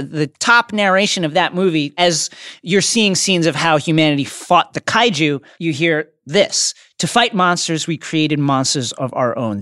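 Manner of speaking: ordinary talk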